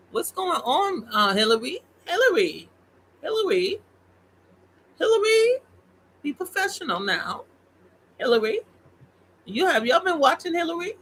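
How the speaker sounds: squealed high, teasing Hillary by name; sing-song high call, scolding Hillary. Acted. Vlogger calling out to Hillary, high and teasing.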